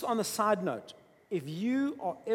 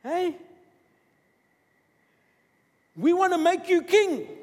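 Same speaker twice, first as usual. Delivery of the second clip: loud, high eager crowd shout; excited hailing shout, much higher and louder; crowd calling out; 13 semitones higher, 11 dB louder than usual. Acted. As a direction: call it out like a thrilled crowd. Crowd hailing Jesus as king.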